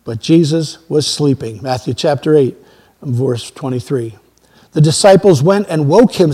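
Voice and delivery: ordinary talk, nothing marked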